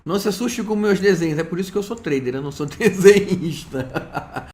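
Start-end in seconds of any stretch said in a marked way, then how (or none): 2.4-4.5 laughing through the last word; amused laughing. Real, not acted. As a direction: joke at your own expense, breaking into laughter. Jokes about own bad drawings.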